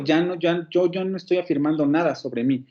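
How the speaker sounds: usual speaking voice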